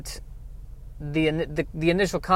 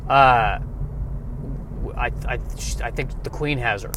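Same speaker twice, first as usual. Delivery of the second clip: low, soft halting reply; hesitant sketch partner; 4 semitones lower, 5 dB quieter than usual. Acted. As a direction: stammer softly in a lowered voice. Timid partner answers the shouting hero.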